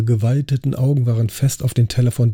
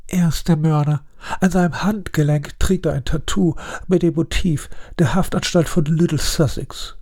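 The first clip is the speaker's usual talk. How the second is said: higher, certain accusation; Sir Arthur accusing; 7 semitones higher than usual. Acted. Sir Arthur accusing the butler before all guests, triumphant. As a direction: lay out the proof like a detective. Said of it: "rief Sir Arthur aus".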